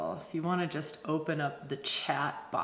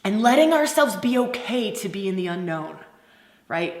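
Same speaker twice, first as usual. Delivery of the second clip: normal talking voice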